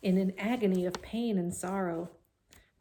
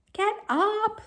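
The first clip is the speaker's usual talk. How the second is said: very high, loud scream; 7 dB louder than usual. A narrator's reading. Wilbur screaming at Templeton, loud high. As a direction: scream at the lazy rat. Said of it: "screamed Wilbur".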